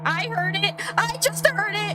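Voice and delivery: high-pitched, spooked exclamation; urgent high squeal of confirmation; alarmed insistence. Real, not acted. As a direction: blurt it out high, freaked out. Player startled by game whisper.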